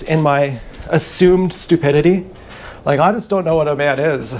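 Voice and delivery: usual speaking voice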